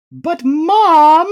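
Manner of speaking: put-on high, loud kid voice; loud, high-pitched child's objection; protesting children. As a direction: cry out in protest like little kids. Voicing whining children in story.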